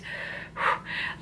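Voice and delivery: long breathy sigh of frustration; exasperated sigh. Real, not acted. Vlogger sighing over the book's hypocrisy. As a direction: let out a tired sigh.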